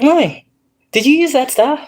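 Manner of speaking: put-on high, curious little-girl voice. Mother imitating daughter asking about the sage, high child voice.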